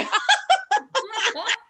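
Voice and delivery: loud high laugh; high burst of laughter. Real, not acted. Laughs after joking.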